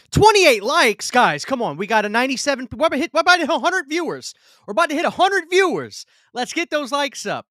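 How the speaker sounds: giddy, fast-talking hype for the viewer count; excited hype. Real, not acted. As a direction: pump up the chat like a cheerleader. Hyping chat toward hundred viewers.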